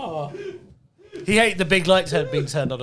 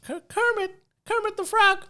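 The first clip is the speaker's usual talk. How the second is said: very high Kermit-like squeak; Jordan Peterson. Retold or imitated. Mocking a famous psychologist, squeaky Kermit-like impression. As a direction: put on a high cartoon frog voice. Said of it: "Can you do Jordan Peterson?".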